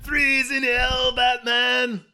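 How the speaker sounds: high, drawn-out villain shout; hammed-up battle cry, stretched out high; mock villain cry. Villain firing a freeze cannon at Batman, hammed-up shout.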